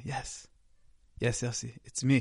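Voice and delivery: calm and tender, smiling; gentle smile. Acted. Rabbi answers his startled student, soft and gentle. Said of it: "with a smile".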